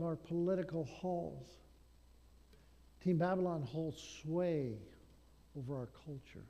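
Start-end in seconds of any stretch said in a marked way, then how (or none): none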